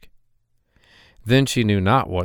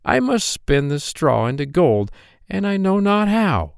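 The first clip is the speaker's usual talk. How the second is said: put-on higher, sad young maiden; maiden's lament; 8 semitones higher than usual. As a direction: sigh it out as the worried girl. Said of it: "said she".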